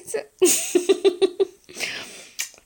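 loud breathy laugh; bursting into breathy laughter. Real, not acted. Laughs at own cheeky line.

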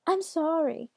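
high, apologetic put-on granny; grandmother voice. A narrator's reading. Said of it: "in his best grandmother voice".

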